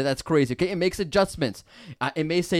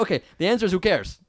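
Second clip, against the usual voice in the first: ordinary talk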